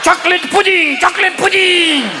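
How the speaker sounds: high, sing-out papal proclamation; demented pope. Acted. Comic plays a confused pope at Yankee Stadium, high intoned proclamation. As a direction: intone it high, stretching the last syllable.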